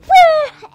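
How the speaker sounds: loud, high startled cry; a sudden high shriek of alarm; Spock's yelp. Retold or imitated. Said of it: "he's like".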